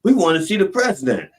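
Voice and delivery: high, loud mock official; officials demanding. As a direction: demand to see him, pushy and loud. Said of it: "And they'd say".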